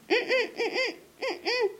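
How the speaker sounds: high, soft mumbled mm-mm; muffled mumble. Acted. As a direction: mumble behind a door. Muffled reply from wardrobe.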